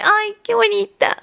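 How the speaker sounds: high, slow, laughing "ay, qué bonita"; gushing mother, laughing; touched mom. Retold or imitated. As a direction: mom melting with a laugh, drawn out. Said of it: "Y mi mamá como de".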